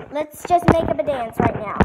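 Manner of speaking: ordinary talk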